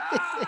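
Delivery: put-on high wailing ah of a crying kid; hammed-up high wail; mock kid wail. Kid's wail after strikeout.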